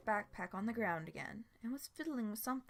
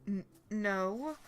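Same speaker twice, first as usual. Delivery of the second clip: louder, words forced through clenched teeth; gritted teeth; 8 dB louder than usual. Acted. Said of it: "he replied through gritted teeth".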